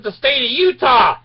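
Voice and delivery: high, loud, annoyed insistence on Utah; exasperated repeat. Retold or imitated. Repeating his home state to the gas station man, exasperated and loud. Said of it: "I said".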